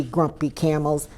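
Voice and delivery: ordinary delivery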